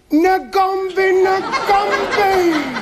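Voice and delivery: high, loud mock crowd chant; crowd going mad. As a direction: whoop it like a crowd beside itself. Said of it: "they were going mad".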